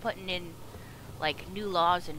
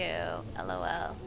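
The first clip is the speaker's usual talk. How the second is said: very low, slow letters; low slow mock-serious L O L; deep spelled-out lol; 3 dB quieter, slower than usual.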